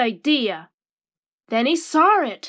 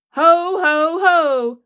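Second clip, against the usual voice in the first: louder, hearty ho ho ho; a big jolly Santa laugh; booming festive chuckle; 4 dB louder, slower than usual. A narrator's reading. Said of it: "laughed Santa".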